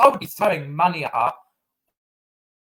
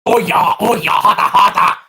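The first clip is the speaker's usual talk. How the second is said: loud hammed-up moans, louder; 3 semitones higher, 10 dB louder than usual. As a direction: shout the fake moans over the top.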